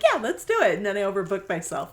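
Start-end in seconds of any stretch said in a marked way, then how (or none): 0.0-0.8 high and loud, over-keen agreement; eager yes. Retold or imitated. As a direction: jump at it like saying yes to everything. Mock eager yes to everything.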